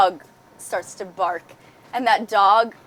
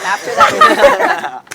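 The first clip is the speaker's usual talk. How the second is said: laughing; 11 semitones higher, 6 dB louder, much slower than usual. Real, not acted. Cracking up while naming someone.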